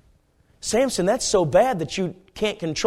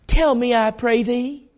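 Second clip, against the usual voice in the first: higher, slow Southern coaxing; Delilah, Southern accent; 8 semitones higher, 3 dB louder, slower than usual. Acted. Delilah asking Samson for his secret, Southern coaxing. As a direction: coax him in a drawn-out Southern accent. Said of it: "probably had a deep southern accent".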